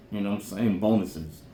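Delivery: ordinary talk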